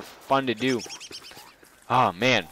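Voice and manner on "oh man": normal talking voice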